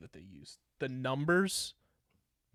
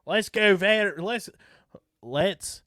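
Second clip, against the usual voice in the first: higher, drawn-out Kentucky twang; Kentucky accent; 6 semitones higher, 5 dB louder, much slower than usual. Shown as a demonstration. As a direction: cheer it like a Kentucky local. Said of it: "Or should I say it in a Kentucky accent?".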